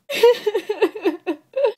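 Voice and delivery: loud, high-pitched burst of laughter. Pretend. Vlogger after her no-prom-date confession, high loud laugh. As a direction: let out a piercing, rapid-fire laugh.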